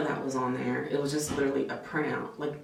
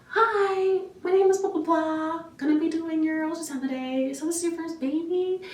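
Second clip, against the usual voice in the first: much higher, louder, perky greeting; bubbly tech voice; 14 semitones higher, 4 dB louder than usual. Retold or imitated. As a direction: chirp it like an overly sunny receptionist. Imitates cheerful new tech.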